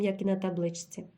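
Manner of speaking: ordinary delivery, nothing marked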